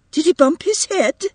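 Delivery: a worried mother checking her son; anxious. A narrator's reading. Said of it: "returned anxiously".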